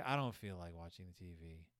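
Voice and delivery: low, soft, listless mock voice; quietly voiced apathy, pitched well down; depressed Mama. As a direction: imitate a mother too down to bother. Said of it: "Is Mama depressed?".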